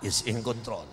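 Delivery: low, slow, loud proclamation; preacher leading the crowd, low and slow; emphatic preaching. Acted. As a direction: proclaim it so the neighbor hears. Congregation repeat phrase.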